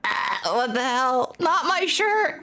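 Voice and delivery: slow, high-pitched horror; slow, high "not my shirt" in disgust; disgusted shock. Real, not acted. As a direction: recoil at the gross shirt. Reacting to snot on shirt.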